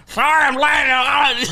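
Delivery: mock scream. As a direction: bellow the apology across the room. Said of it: "He's walking through this beautiful steakhouse screaming".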